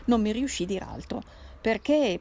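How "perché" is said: sudden louder burst on one word; outburst. A narrator's reading. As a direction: burst out with the question. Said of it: "proruppe lei". (scatto improvviso, a voce alta)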